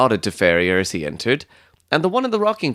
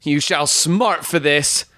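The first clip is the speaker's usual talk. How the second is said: high, drawn-out indignant threat; enraged threat; 6 semitones higher, slower than usual. Acted. Stangerson threatening Ferrier as he leaves, in high-pitched rage.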